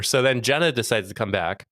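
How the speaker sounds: ordinary talk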